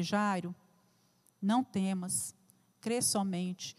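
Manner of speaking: very soft, gentle reassurance with slow pauses; tender Jesus voice. Acted. Jesus comforts the weeping father, soft and tender. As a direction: comfort a broken father, almost in a murmur. Jesus speaks to grieving Jairo.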